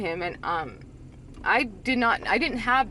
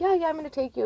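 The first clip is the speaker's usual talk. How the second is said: mock placating, pitched up high; dismissive brush-off; 8 semitones higher, much faster than usual. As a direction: imitate a boyfriend fobbing you off. Imitating boyfriend brushing her off.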